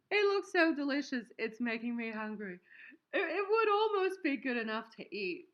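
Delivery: high, loud words through laughter; laughing at her own delicious-looking soap. Real, not acted. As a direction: gush while still giggling. Laughing while reading joke.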